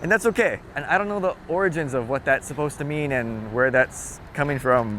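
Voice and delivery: ordinary delivery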